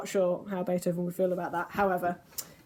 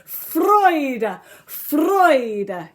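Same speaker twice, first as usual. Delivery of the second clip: Freude called out higher and louder with joy; two joyful cries of Freude; full of joy; 5 semitones higher, 9 dB louder than usual. Shown as a demonstration. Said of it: "as if you're full of joy".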